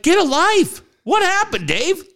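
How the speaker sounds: high mock exasperated Shatner; high, exasperated mock scolding; Shatner SNL impression. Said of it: "it's the Shatner Saturday Night Live thing".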